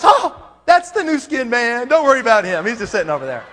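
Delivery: a hint of the cheerful grandma; older lady voice. Grandma explains him to parents.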